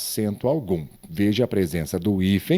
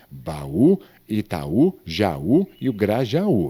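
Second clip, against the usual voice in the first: stressed final ú in "Baú", "Itaú", "Jaú", "Grajaú"; separated hiatus; slower than usual. Shown as a demonstration. Teacher lists examples of hiatus in u, separated hiatus. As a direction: lean on each last ú of "Baú, Itaú, Jaú e o Grajaú" as its own syllable. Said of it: "eu separei só o hiato".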